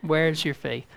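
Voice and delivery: softly, without reproach; gentle. Retold or imitated. Jesus gently asking his frightened disciples, softly. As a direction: ask it gently, like a kind teacher. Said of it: "I think he said gently to him".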